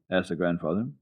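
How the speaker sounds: plain reading voice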